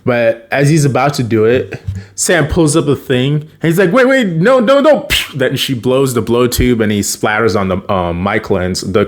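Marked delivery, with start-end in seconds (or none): 3.9-5.1 high, hurried mock panic; retold alarmed protest. He sees the blow tube coming, alarmed. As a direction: plead to stop it, much higher.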